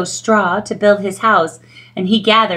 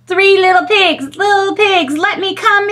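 demanding wolf; 10 semitones higher than usual. Acted. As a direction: insist at the door. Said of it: "demanded the wolf".

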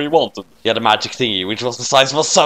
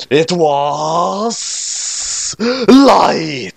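higher, very slow, drawn-out reveal; dramatic reveal; 6 semitones higher, much slower than usual. Acted. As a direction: stretch it out like a soap opera twist.

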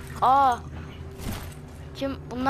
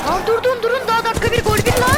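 panicked shout; 10 semitones higher, 7 dB louder than usual. Real, not acted. Panicked under sudden attack.

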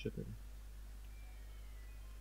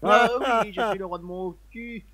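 mocking; 11 semitones higher, 9 dB louder than usual. Mocks friend's king line.

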